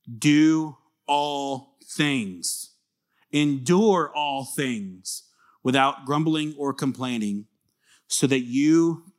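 very slow, each word stressed; emphatic scripture reading. Shown as a demonstration. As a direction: spell the command out word by word. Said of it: "It says".

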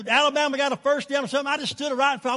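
ordinary talk

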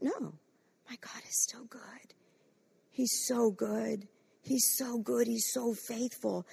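soft, slow, hushed worship; gentle, heartfelt reply; tender praise. Acted. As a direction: say it quietly, in reverent awe. Tender answer of praise to enemy.

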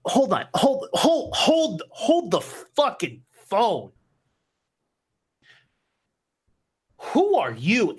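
stammer, then slow down on the phone; startled boss. Acted. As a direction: boss stopping cold at a stranger. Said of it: "looks back at him and goes".

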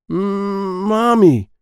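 high, loud call for mom. Acted. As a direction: cry out for help. Child trapped in crib calls.

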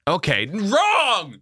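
high, slow cry of wrong; surprised. Real, not acted. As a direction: caught out and yelping it. Website proves him wrong.

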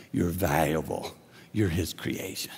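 hushed low reassurance; soft low reassurance. Real, not acted. Preacher assuring the unworthy.

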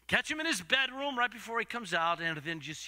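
normal talking voice

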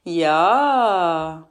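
long, swelling scoff; mocking crowd reaction; drawn-out jeer. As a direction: stretch it out in disbelief. Women react to the claim.

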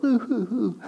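high, dainty imitation boo-hoo; mock quiet weeping. Preacher imitating polite Kleenex crying, mock quiet weeping. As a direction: mimic a small, private sob into a Kleenex. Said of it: "weeping is not that quiet".

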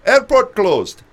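high, loud mock Indian announcer; imitating Indian accent. Announcer tells waiting crowd, high loud Indian accent mimicry. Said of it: "she gets on, she says".

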